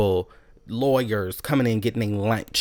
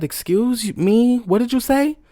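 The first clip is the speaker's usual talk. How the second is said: higher, louder, challenging; indignant demand; 10 semitones higher, 4 dB louder, slower than usual. Retold or imitated. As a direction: demand he repeat it. Confronting manager.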